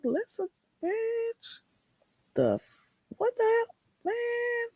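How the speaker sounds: hammed-up disbelief, drawn out and high; mock exasperated retort. Retold exasperated retort to streamer.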